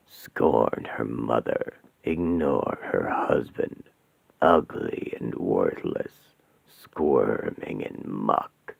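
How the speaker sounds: very low, slow, hollow demonic voice. Acted. Demon Asmodeus greeting the trapped women, low and menacing. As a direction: loom over them like a demon.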